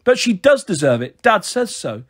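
mildly tearful protest; starting to whimper. A narrator's reading. Boy being shaken by his sister, whimpering. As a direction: insist like a child near tears. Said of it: "starting to whimper".

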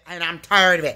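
ordinary delivery

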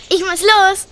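high and loud, rushing off; breathless excitement before the casting; excited hurry. Acted. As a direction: call out eagerly as you dash away.

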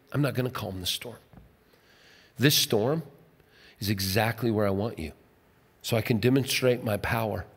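a quiet, intimate divine voice; God speaking tenderly. Retold or imitated. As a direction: speak as God, hushed and reassuring.

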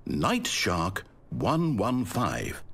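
slow, careful recitation; slow, deliberate. Acted. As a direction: read each word of the code slowly. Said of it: "Stanley spoke the code".

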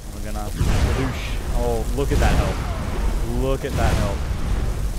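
drawn-out excited gamer hype; slow, loud and thrilled. Real, not acted. Gamer excitement at big hit.

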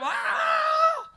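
high sobbing wail of pain; crying scream. Retold or imitated. Injured player crying out to his friend in pain. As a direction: wail through the tears.

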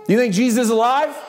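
questioner. Acted. Acted questioner in dialogue.

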